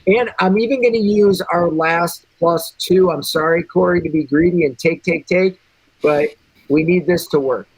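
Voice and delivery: usual speaking voice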